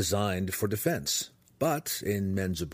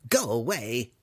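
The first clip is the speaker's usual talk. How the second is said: restrained yell of a cranky hermit; 3 semitones higher than usual. Acted. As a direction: shoo them off crossly. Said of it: "the human yelled".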